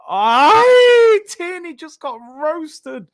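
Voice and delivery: sudden high yelp, then falling away in disbelief; shocked outburst. Real, not acted. Fan yelping at Tierney getting nutmegged, shocked. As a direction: gasp it out, stunned by the nutmeg.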